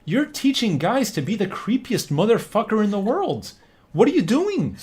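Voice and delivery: high incredulous scolding; outraged at rom-com clichés, voice high; exasperated rant. Retold or imitated. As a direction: pitch it up in disbelief. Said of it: "I'm like".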